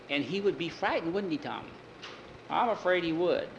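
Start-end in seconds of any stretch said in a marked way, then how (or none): none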